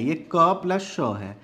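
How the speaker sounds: ordinary delivery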